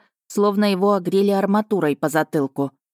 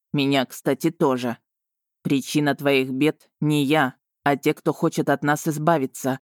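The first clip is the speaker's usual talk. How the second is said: patient dragon, pitched slightly down; slow and patient; 2 semitones lower than usual. A narrator's reading. Dragon explains to Marina who is to blame, patiently. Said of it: "проговорил он медленно и терпеливо".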